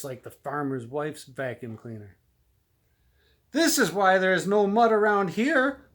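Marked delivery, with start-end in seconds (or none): 3.5-5.9 high, loud angry cry; cries out. A narrator's reading. Pig cries out at the vacuum cleaner. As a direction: shout it in outrage. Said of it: "cries the small pig".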